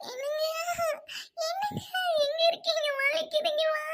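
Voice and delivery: high, wailing call through pain. Acted. Wife in labour calls for her husband, in pain. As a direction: cry out weakly for help.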